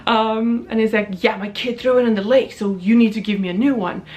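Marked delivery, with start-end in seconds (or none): 1.2-4.0 a hint of a surly, entitled man; lightly voiced sullen demanding guy; mild mock rude customer. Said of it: "he has a terrible tone".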